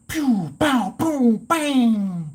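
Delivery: high, playful boom and bang; explosion sounds. Retold or imitated. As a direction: mimic explosions with your mouth. Imitating explosions.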